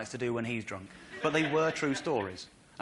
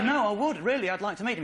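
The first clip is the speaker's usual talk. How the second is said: louder, higher retold pleading; earnest protest; 6 semitones higher, 4 dB louder than usual. Comic retelling his plea to the man at the club door, insistent. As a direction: push back eagerly after being refused.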